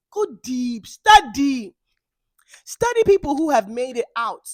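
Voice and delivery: loud ranting shout; furious rant at the viewers; yelling. Real, not acted. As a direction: yell it at them, fed up. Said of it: "that's all the yelling I have for me today".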